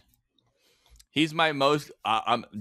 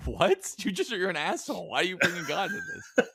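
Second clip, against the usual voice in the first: mock indignation; 7 semitones higher than usual. Acting out indignant victim of theft.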